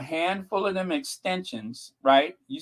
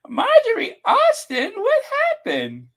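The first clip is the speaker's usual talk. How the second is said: innocent voice; 5 dB louder than usual. Pretend. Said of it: "As I say in that very innocent voice".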